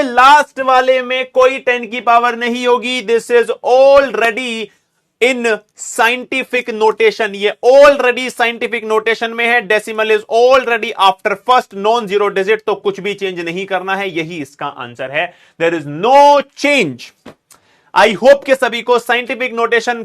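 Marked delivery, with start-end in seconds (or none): 3.0-12.6 slow emphasis. Shown as a demonstration. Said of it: "teacher stresses rule, drawn out". 15.6-17.0 drawn-out statement. Shown as a demonstration. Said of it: "teacher drawn-out conclusion".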